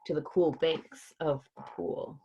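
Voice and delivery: usual speaking voice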